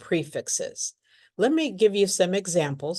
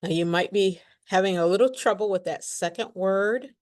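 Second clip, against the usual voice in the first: ordinary delivery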